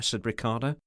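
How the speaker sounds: ordinary delivery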